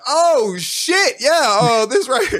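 high, loud, flustered surprise; startled clerk. Retold or imitated. Gas station clerk seeing a shot customer, loud high alarm. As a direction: blurt it out, caught completely off guard.

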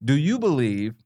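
normal talking voice

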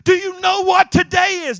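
high loud excited shout; overjoyed high shout to the whole town. Retold or imitated. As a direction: call out to everyone in delight. Phil running round telling everybody.

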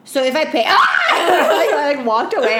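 loud, high laughing shout; half laugh, half shout; laughing outburst. Real, not acted. Retelling the customer's question, laughing outburst.

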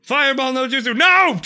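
high, loud battle cry; anime attack shout. Pretend. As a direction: yell the ninja move.

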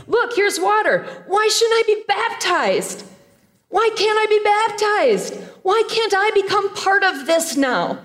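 the eunuch begging eagerly to be baptized; eager plea. Retold or imitated. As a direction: ask to be baptized like you can't wait.

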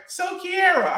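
high mock Kiara with extra vowel; mock mispronunciation. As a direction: say Kiara like a clueless stranger, "Ki-a-ra". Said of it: "It's like".

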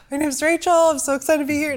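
exaggerated chirpy self-introduction to the coaches; mock perky intro. Shown as a demonstration. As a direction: overdo it like a giddy newcomer. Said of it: "No, not like that".

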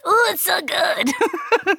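high, slow squeal through laughter; laughing delight. Real, not acted.

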